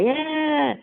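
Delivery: high, drawn-out mock wail; a brief high-pitched imitation cry; imitated baby cry. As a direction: mimic a baby crying out. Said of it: "she was crying".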